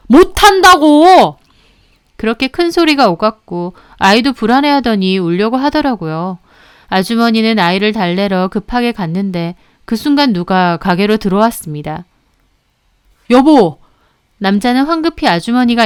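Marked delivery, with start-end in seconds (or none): 0.1-1.4 slow, loud, high yell; shouted refusal. Acted. Boss refusing to apologize, shouting. 13.3-13.8 loud call across the room. Acted. Husband bursting in, calling his wife.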